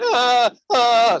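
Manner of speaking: high mock sob, "ah, ah"; mock crying. Man hears a little boy crying, high mock sob. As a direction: fake a little boy's whimpering cry.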